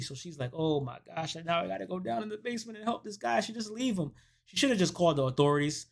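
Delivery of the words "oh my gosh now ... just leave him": high, soft, rushed grumble; retold fretting, pitched up; mock dismayed reluctance. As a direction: voice her dreading the rescue. Woman reluctant to go help.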